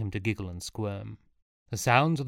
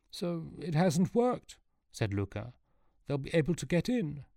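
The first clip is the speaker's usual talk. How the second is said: worried character voice; 7 semitones higher than usual. A narrator's reading. Luca worried.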